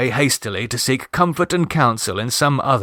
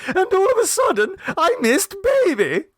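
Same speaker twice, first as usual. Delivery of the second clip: shriller tone. A narrator's reading. Mrs Mummerby tells Clovis the baby vanished, shrill and high. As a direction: push the mother's voice up to shrill. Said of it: "in a shriller tone".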